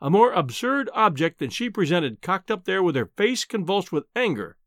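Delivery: plain reading voice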